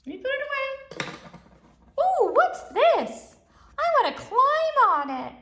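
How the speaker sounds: high, bouncy little toy voice, full of glee; playful toy character. Acted. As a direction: play a cheerful little toy who just rode in. Host voices a motorcycle-riding toy character.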